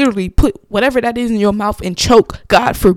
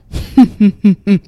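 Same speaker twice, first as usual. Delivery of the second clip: imitated laugh; 5 dB louder than usual. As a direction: copy the client's laugh. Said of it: "she does her laugh like".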